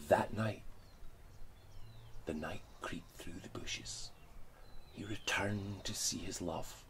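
hushed, breathy story narration; whispered storytelling. A narrator's reading. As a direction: whisper the tale like a secret.

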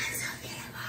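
barely-there, awestruck whisper; hushed, overcome with wonder; overwhelmed whisper. Real, not acted. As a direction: whisper in teary awe. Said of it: "gonna cry".

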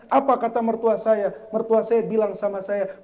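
ordinary talk

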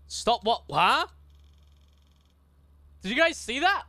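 loud startled questions; alarmed. Real, not acted. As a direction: react in sudden alarm. Alarmed at hidden message.

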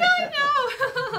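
loud laughing protest. Real, not acted. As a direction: laugh through the words. Laughing at marathon joke.

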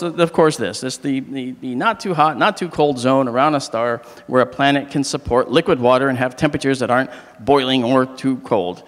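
ordinary talk

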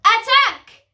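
high, loud shout; battle cry. A narrator's reading. Parrot leader sending his crew into battle, loud cry. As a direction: cry the order out sharply. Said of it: "cried Jim".

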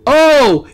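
loud, high gasped shout; startled cry. Real, not acted. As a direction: cry out in surprise. Startled by mountain appearing.

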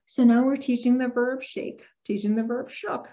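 normal talking voice

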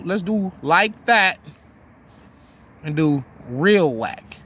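slow demo. Shown as a demonstration. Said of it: "Let's say I said like that".

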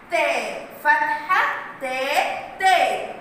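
slow تاء فتحة then a punchier تاء; phonics drill. Shown as a demonstration. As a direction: spell the syllable out for children. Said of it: "والفتحة".

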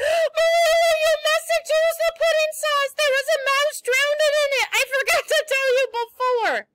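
high, loud panicked shriek. Acted. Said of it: "shrieked out".

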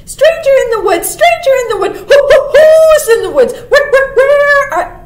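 high, loud, rapid bird alarm; calling jay. A narrator's reading. Jay warning of a stranger in the woods, loud and high. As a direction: sound the alarm like a startled bird. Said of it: "did the jay say".

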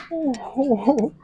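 normal talking voice

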